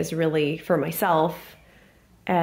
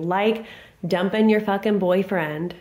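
ordinary talk, nothing marked

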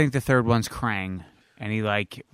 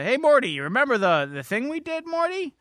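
excitable cartoon call; Rick; 10 semitones higher than usual. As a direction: push it up high for an insistent cartoon figure. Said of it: "Rick goes".

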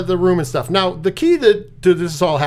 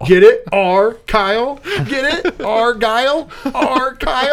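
mocking; 7 semitones higher, 3 dB louder, slower than usual. Teasing the pun on the name.

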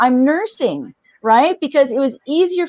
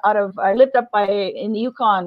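normal talking voice

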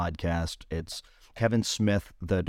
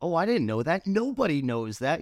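higher, lightly voiced, oblivious surprise; a hint of a clueless guy; clueless friend; 8 semitones higher than usual. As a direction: act innocently surprised. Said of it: "He's like".

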